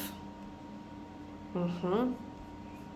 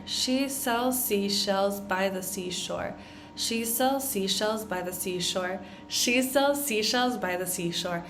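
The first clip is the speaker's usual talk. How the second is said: faster and faster. Shown as a demonstration. Teacher drills the tongue twister at rising speed. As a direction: repeat the line, speeding up each time. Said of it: "getting faster and faster".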